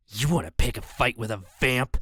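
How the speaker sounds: a lightly voiced vampire brute snarling; snarling threat. A narrator's reading. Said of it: "he snarled".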